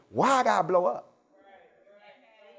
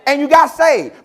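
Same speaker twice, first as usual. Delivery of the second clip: 1 high loud preaching call; 7 semitones higher, 7 dB louder, slower than usual. Real, not acted. Preacher calls out congregation.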